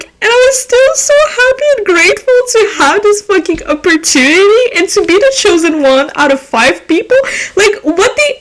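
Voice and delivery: overexcited half-sobbing laughter; hysterical. Real, not acted. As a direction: gush like you can't believe it. Hysterical rant about being hired.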